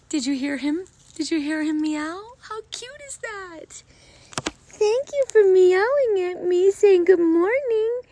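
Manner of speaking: high, slow cooing pet voice. Real, not acted. Thanking the cat for meowing, in a high slow coo.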